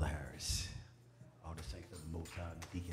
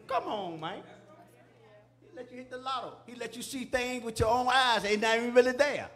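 high, loud, rising pulpit cry; building to a big, ringing peak; fired-up preaching; 12 semitones higher, 11 dB louder than usual. Real, not acted. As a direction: preach it at full force, pitched high above your talking voice. Preaches at full force.

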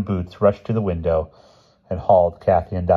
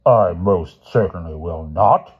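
slow, loud headmaster refusal; emphatic, drawn-out indignation; indignant exclamation; 7 dB louder, slower than usual. A narrator's reading. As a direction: refuse loudly, weighing out each word. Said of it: "Mister Sturgeon exclaimed".

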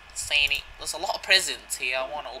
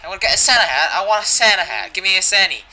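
loud, high mock-childish demand; high, insistent playful begging; excited demand; 5 semitones higher, 9 dB louder than usual. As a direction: clamour for it loudly. Demanding a Santa hat.

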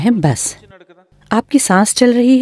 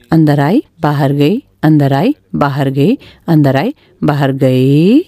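low phrases, each swooping up at the end; chant-like, low with rising endings; rhythmic breath count; 6 semitones lower than usual. Shown as a demonstration. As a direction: trace each breath in and out, stretching the last one. Acting out breath going in and out.

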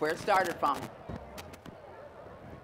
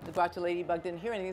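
ordinary delivery, nothing marked

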